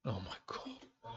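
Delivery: slow, breathy whispered dismay; despairing whisper. Real, not acted. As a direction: let it fall out in quiet disbelief. Despair at another draw.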